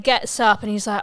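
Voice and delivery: usual speaking voice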